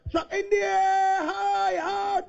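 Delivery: high-pitched call of a big hit; excited call. Real, not acted. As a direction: sell the six with energy. Big hit for six called.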